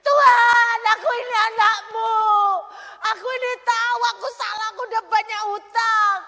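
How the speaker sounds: shouted, high, desperate pleading to God; imitating a woman's choleric prayer, shouting. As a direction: cry out to God, demanding. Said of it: "Koleris gini".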